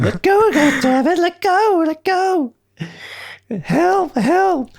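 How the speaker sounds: very high squeaky mock cries; broken adolescent voice. Host imitates young John struggling with Terminator, broken squeal. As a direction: yelp loudly like a panicking squeaky boy. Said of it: "he discovers that the Terminator".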